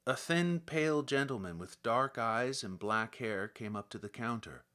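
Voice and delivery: ordinary delivery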